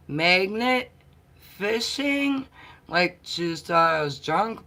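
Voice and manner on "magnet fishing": "fishing?" rising high and questioning; echoing "Magnet fishing?" in disbelief; puzzled echo. Retold or imitated. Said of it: "she's all".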